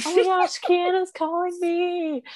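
high, drawn-out squeal; very high, stretched-out imitation of excitement; retold giddy excitement. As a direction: squeal it like a thrilled friend. Acting out excitement at call.

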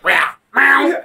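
high, loud double swoosh; imitated lightsaber swings. Joking about Darth Maul's moves, imitated lightsaber noise. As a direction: mouth two saber swipes.

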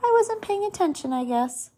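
mild put-on casualness; feigned innocence. A narrator's reading. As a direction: play dumb, lightly. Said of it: "pretending also".

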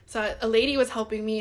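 ordinary talk, nothing marked

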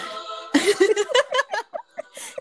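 squealing laugh; high laugh. Real, not acted. Laughs hard at the story.

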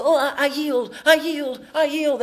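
high, pleading, mock-meek yielding; meek surrender. Preacher mocks a meek Jesus giving in, high and pleading. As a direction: squeak out a feeble surrender. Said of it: "he didn't just go".

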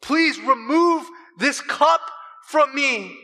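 slow, high, anguished plea; Jesus pleading. Acted. Jesus begs his Father to take the cup, slow and high. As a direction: beg the Father slowly, voice lifted high.

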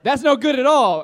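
loud, high acted reaction; imitated patient. Fellow patient reacting to the injury, loud high imitation. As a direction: put on a loud commiserating stranger.

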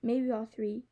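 ordinary delivery, nothing marked

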